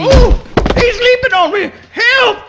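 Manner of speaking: high, loud panicked yelp; bowled over by the dog, calling for help; alarmed cry for help. Acted. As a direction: cry out as he's knocked flat. Dog jumping on Pogo.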